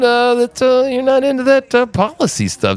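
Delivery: high, prissy fake-polite voice; mock polite concern. Host reenacting politely needling the guest in a high fake-polite voice. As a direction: feign delicate concern. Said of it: "I said".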